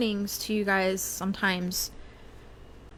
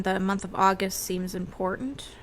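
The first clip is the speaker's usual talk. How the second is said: ordinary talk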